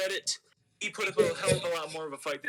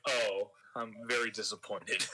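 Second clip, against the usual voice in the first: a lightly voiced, lower, let-down sigh of a line; mock disappointed; 8 semitones lower than usual. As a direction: imitate him, much lower and understated. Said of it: "He's like".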